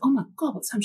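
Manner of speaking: mock alarm. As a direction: replay your own worried gasp lightly. Retelling alarm at upset colleague.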